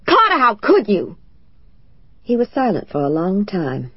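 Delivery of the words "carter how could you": high, loud reproach; anguished cry. Acted. Wife crying out in hurt at her husband, high and loud. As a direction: cry his name out in hurt.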